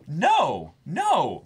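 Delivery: high, loud retold "no"; firm refusal. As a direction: put your foot down with a kid. Retold refusal to misbehaving kid.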